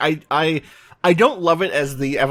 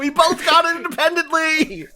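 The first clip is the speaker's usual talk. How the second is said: high, laughing excitement; excited high voice; 12 semitones higher than usual. Real, not acted. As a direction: stay high and giddy. Still celebrating guessing right.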